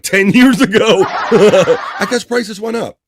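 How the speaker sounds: high, loud, slow words through laughter; laughing. Real, not acted. Host cracking up reading a chatter's joke. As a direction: laugh while getting the words out.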